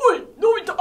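loud, gruff bully shout; Gian impression. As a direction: bark at Nobita like a bully. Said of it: "ジャイアンのモノマネいきます". (大声で威張るジャイアンのモノマネ)

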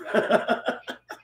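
high breathless giggle; cracking up at her own joke; airy laugh. Real, not acted. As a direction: burst into a silent high laugh.

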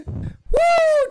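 high-pitched woo; exhilarated whoop. Real, not acted. Streamer finishing birthday song, exhilarated whoop. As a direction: let out a triumphant whoop.

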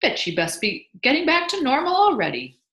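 loud, high-pitched enthusiasm; proud, excited swoops; beaming delight. A narrator's reading. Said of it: "he cried, beaming".

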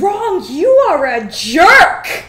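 gasp, then a loud indignant yell; hammed-up betrayed shout; mock outrage. Mock outrage at rival's move.

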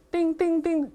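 very high, separate dings; high-pitched little chimes; clinking ice imitation. Shown as a demonstration. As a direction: imitate ice cubes tapping a glass. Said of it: "这冰块儿跟杯子碰撞的声音".

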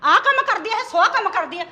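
loud mocking repeat, hitting ਕੰਮ; sarcastic echo. Mother mocking her son's defence of his wife, sarcastic. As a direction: throw his words back at him with scorn.

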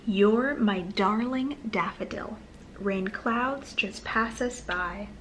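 plain reading voice

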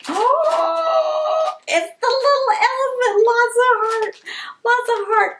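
high thrilled oh; delighted. Real, not acted. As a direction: coo over the little toy. Unwrapping a toy.

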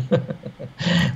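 a light real laugh; brief amused chuckle; short laugh. Real, not acted. Amused after own remark.

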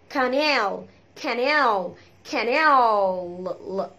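"كانال" as ka-NEAL with long dark L; dark L canal. Shown as a demonstration. Teacher drilling attention to L, "كانال" with heavy L. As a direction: draw out the final L of "كانال". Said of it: "ال بقى نخلي بالنا منها".

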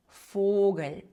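Фогель starting with f, long o; German Vogel with an f onset; V read as F. Shown as a demonstration. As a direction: begin Фогель with a voiceless f. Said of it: "читається як звук".

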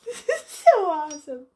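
teary laugh of joy; laughing through tears. Real, not acted. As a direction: let relief spill into laughter.

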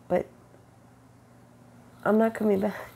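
ordinary delivery

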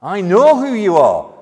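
high, loud declaration; demon's cry of recognition. Acted. Demon declaring it knows Jesus, loud certain cry. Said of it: "The demon knows exactly who he is".

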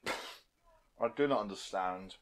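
slow, quiet mock German accent; stretched-out, hushed German accent. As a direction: put on a crude German accent. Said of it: "your racist German accent".

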